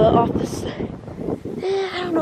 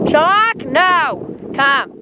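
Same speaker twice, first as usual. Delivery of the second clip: calling a dog; 7 semitones higher, much slower than usual. Real, not acted. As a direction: shout for the dog to come.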